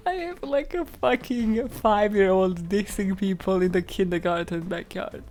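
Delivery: ordinary delivery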